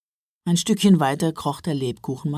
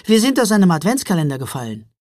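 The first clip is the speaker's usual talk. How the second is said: fast, louder eager blurt; 5 semitones higher, 6 dB louder, faster than usual. A narrator's reading. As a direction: burst out with it all at once. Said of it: "platzte Jonas heraus". (herausplatzen, schnell und aufgeregt)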